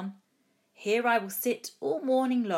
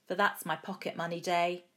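usual speaking voice

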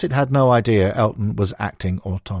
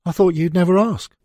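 a light touch of [name], higher and pleased; just hint at [name]'s delight, no full impression; [name]'s eager reply; 10 semitones higher, 3 dB louder than usual. [name] on the phone to Christine, lightly voiced and eager. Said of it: "said [name]".

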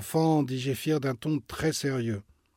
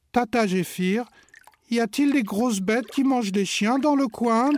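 higher, louder worried question; anxious child; 9 semitones higher, 6 dB louder than usual. Acted. Agathe asking her aunt about beasts, higher and worried. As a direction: ask the aunt nervously. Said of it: "demande Agathe".

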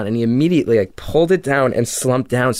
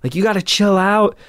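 higher, pushed mock shout to calm him; retold yelling back; 9 semitones higher than usual. Narrator yelling back at the angry driver, raised mock shout. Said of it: "we're yelling at each other".

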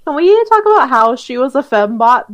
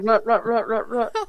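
gunfire noise; 7 dB quieter than usual. Retold or imitated. Imitating gunfire sound.

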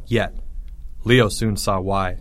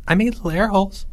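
high, chirpy little voice; cheerful voice; 8 semitones higher, faster than usual. A narrator's reading. Geoffrey from further down the hole, cheerful voice. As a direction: pipe up cheerfully. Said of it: "came a cheerful voice".

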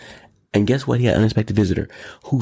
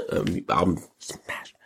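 slow softer whispered aside; 4 semitones lower, 7 dB quieter, much slower than usual. Acted. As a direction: breathe it like a secret.

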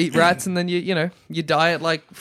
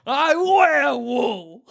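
higher, slow shouted taunt; mock taunt at sheep; 4 semitones higher, slower than usual. Player taunting a sheep about its wool, slow mock shout.

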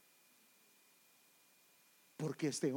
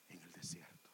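whispered words fading into silence; 14 semitones lower, 17 dB quieter than usual. Acted. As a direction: breathe the words out with no voice. Preacher quotes John, fading out. (susurrado, apagándose)

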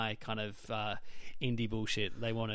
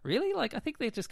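incredulous really; 12 semitones higher than usual. Retold or imitated. Sceptical reply to Cure fans.

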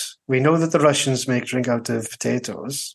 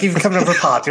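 ordinary talk, nothing marked

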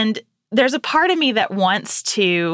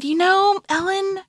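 gentle mom voice; 7 semitones higher than usual. Retold or imitated. Mother gently answering daughter about death.

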